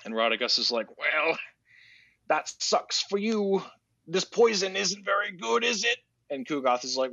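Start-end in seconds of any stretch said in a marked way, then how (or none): 1.0-6.0 high, slow mocking character voice; taunting visitor. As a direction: taunt him in a high, drawn-out singsong. Mocking villain voiced in retelling.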